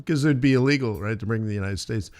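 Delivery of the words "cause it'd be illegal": usual speaking voice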